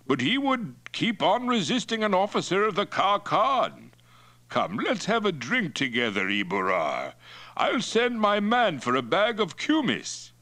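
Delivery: high, blustering host; Mongol chief Toktai, hearty. Acted. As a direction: play the chief offering hospitality.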